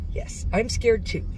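ordinary talk, nothing marked

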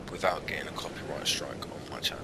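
quick soft whisper. Real, not acted. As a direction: whisper it fast under your breath. Aside whisper to himself.

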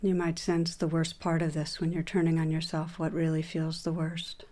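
calm, even voice with long pauses between phrases; guided meditation. Acted. Teacher leading listeners through a meditation on shame, guided meditation voice. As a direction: guide them gently inward, leaving room to settle.

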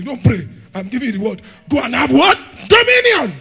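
loud, high, shouting preacher; fiery preaching. Acted. Preacher rouses church about dominion, shouting loud and high. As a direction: hammer each line at the congregation.

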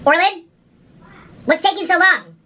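impatient call across house. Real, not acted. Calling to husband impatiently.

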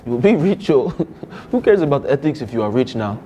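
put-on lightly held Nigerian accent, earnest student; a Nigerian student pleading with the teacher. To a geography teacher, a mild Nigerian accent. Persona pushes the scam.

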